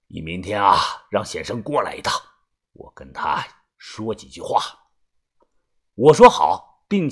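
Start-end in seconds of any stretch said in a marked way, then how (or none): 0.1-4.6 much lower, soft elder voice; old man. A narrator's reading. Grandpa asks narrator to fetch Xiansheng, low old-man voice. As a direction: give a quiet instruction.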